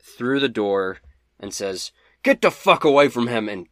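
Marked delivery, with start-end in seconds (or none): 2.2-3.5 loud, higher-pitched urgent warning; urgent yell. Acted. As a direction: yell it as you rush to the rescue. GM voices Quinn rushing in.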